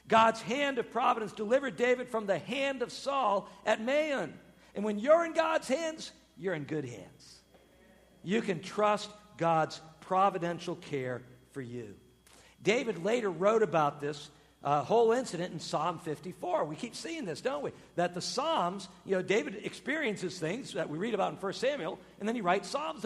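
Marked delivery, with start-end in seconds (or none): none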